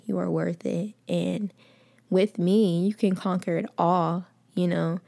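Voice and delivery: ordinary talk, nothing marked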